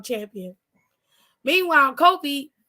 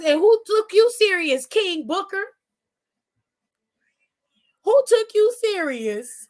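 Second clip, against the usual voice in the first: high, mocking challenge; a pitched-up taunting roast; 6 semitones higher, 3 dB louder than usual. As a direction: needle him with each question. Roasting Booker T directly.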